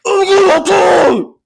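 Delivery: high, slow strained crying yell; mock desperate scream. Imagined man threatening himself on camera, mock desperate scream. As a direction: scream it red-faced, veins popping, hammed up. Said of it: "red in the face".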